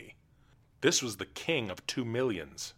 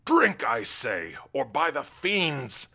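slow, loud threat; menacing king; 5 semitones higher, 5 dB louder, slower than usual. Acted. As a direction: threaten him slowly.

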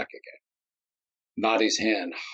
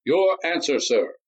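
higher, formal intoning; senator intoning; 8 semitones higher, 4 dB louder than usual. A narrator's reading. The senator demanding the captain's answer, formal. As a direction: preside like a congressional debate. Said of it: "the senator intoned".

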